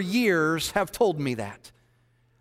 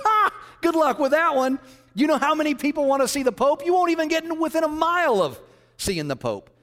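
mocking scoff; 8 semitones higher than usual. Beautician mocks the Pope plan.

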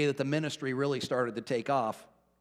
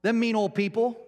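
higher, mock-pitying voice of God; imagined sympathetic God; 7 semitones higher than usual. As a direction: play God siding with a sulker. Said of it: "I wanted him to say".

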